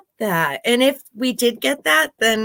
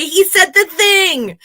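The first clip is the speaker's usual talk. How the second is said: excited; 8 semitones higher, 5 dB louder than usual. Pretend. Excited fan cheering.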